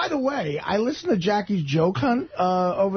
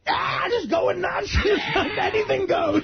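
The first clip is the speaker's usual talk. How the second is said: mock wild partying; 12 semitones higher, slower than usual. Mimicking a wild party guy.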